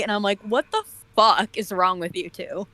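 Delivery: ordinary delivery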